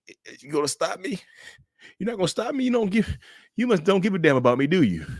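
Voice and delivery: high, whimpering fake-cry voice; mock tearful guilt-trip. Cheater pleads to be stopped, high mock-tearful whine. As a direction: imitate a cheater crying for sympathy. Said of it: "they're sorry 'cause they're crying".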